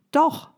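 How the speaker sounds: a sharp, high "Doch" that shuts the excuse down; emphatic contradiction. Real, not acted. Coach rebuts the applicant's excuse with a firm, high "Doch". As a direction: snap back "Doch", pitched well up and punched.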